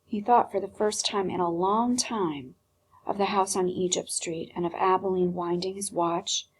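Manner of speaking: plain reading voice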